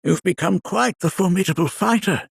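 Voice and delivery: ordinary delivery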